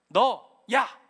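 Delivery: a sudden loud angry 너, 야; parent scolding. Retold or imitated. Pastor voicing a scolding parent, loud mock yell. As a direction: bark it like a furious parent.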